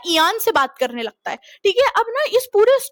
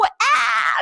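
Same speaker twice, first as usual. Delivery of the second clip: witch scream; 5 semitones higher than usual. Retold or imitated. Girlfriend screaming at night, mock witch wail. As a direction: wail like a witch, half-hearted. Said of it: "चुड़ैल की तरह चिल्ला रही".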